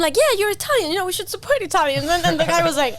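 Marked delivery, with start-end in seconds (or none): 0.2-2.0 eager pitch. Retold or imitated. Eager pitch to shopkeeper retold.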